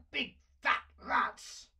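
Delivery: high, loud, slow furious curse; furious outburst. A narrator's reading. Dragon cursing as the fire blackens ceiling, furious and loud. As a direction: blow up at the spreading fire.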